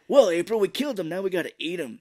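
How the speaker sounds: hammed-up cartoonish explaining; mock turtle voice. Jokingly acts out the turtles.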